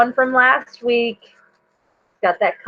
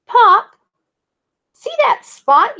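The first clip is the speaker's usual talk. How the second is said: much higher and loud call; yelled question; 13 semitones higher, 5 dB louder, slower than usual. A narrator's reading. Val yelling to Pop about a spot, high and loud. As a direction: shout to get Pop's attention. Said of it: "yells Val".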